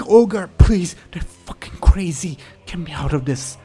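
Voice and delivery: high, loud pleading weasel; desperate scream. Acted. Weasel begging the ogre to get him out, screaming. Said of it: "The weasel will scream".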